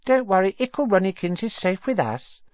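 high teasing sing-song. Acted. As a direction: tease little brother. Twin teasing baby-talk.

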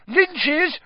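high, sharp yell. A narrator's reading. Harry correcting his friends at the match, yelling. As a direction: yell the correction over the crowd. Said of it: "yelled Harry".

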